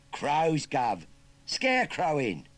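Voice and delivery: high, loud cheeky Cockney lad; chirpy London street kid rattling off slang; perky Cockney urchin. Acted. Urchin asks farmer about the job in Cockney.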